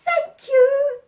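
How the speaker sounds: very high, loud squeaky thanks; baby mice squeaking their thanks; squeaked. A narrator's reading. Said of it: "they squeaked".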